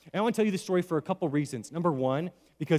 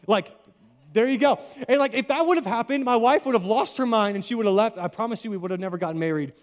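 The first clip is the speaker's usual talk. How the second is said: usual speaking voice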